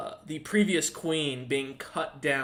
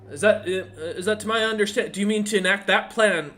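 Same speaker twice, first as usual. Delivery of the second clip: startled messenger; 5 semitones higher, 6 dB louder than usual. Acted. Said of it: "And he goes".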